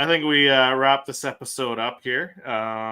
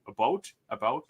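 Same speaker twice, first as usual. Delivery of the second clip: "about" leaning toward "a-boat"; mother-in-law's Canadian accent. Retold or imitated. Showing how mother-in-law talks, Canadian "a-boat". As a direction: imitate a Canadian "about" with a rounded OH. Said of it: "she'll say about".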